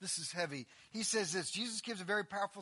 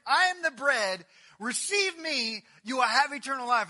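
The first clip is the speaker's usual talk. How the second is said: shouted preaching; 6 semitones higher, 11 dB louder than usual. Acted.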